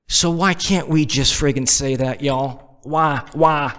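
slightly put-on Southern accent. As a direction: slip into a Southern twang. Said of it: "go to a Southern accent".

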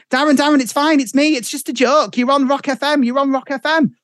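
high, urgent imitation of the wife; acted plea to calm him down; wife's reveal. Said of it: "and she's like".

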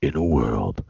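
very low, deep trailer announcer; movie trailer voice. Retold or imitated. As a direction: boom it out like an epic trailer narrator. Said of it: "Then you gotta say".

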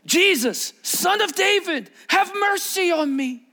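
high, drawn-out cry for mercy; yelled plea. Acted. Blind beggar calling out to Jesus as he passes, yelled plea. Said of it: "he yelled".

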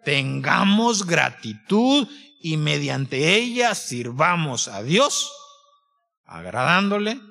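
drawn-out words, spaced for weight; slow right down and land each phrase; slow, emphatic preaching. Real, not acted. Preacher closing the Hebrews passage for the congregation, slow and emphatic. Preacher drives home the verse's climax.

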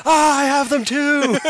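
panicked moon. Retold or imitated. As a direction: shriek it out like a cartoon moon in a panic. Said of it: "and the moon goes".

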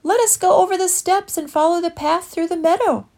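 eager urging, then doubt; character voices. Acted. Friends debate the meadow path.

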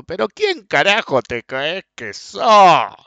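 slow, drawn-out mocking rage; angry yell. Retelling the rude man yelling at him in the ice-cream shop, slow mock rage. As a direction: spit out the insult slowly. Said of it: "me dice".

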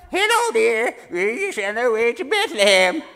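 high, loud sweet granny voice; old lady voice. Acted. Tough warrior in disguise greets guards, high old-lady voice. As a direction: play a warrior faking a dear old lady. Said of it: "dressed up as old ladies".

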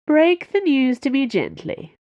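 high, lightly amused lordly voice; something like a laugh. A narrator's reading. Milord asking his handler for news, half laughing. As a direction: lift it as if about to laugh. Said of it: "with something like a laugh".

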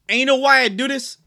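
loud, high, hammed-up Jersey guy; New Jersey accent. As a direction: imitate a Jersey author posing a big question. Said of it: "dude from New Jersey".

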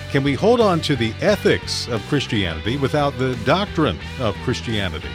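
ordinary delivery

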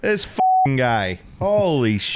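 hammed-up high New York accent; mock New York accent about watching Donnie Schatz; terrible New York accent. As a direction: play a brash New York guy in a bad New York accent. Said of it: "We're just doing terrible New York accents now".

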